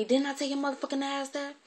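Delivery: high-pitched scolding demand; going off. Retold or imitated. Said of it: "she really went off then".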